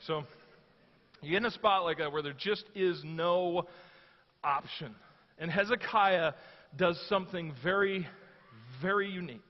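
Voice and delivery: usual speaking voice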